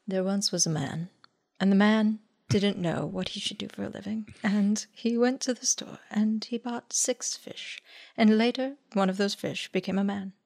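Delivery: soft, understated parable-telling voice; Jesus. Acted. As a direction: tell a parable quietly, with mock solemnity. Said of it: "Jesus's responses to questions are so wild".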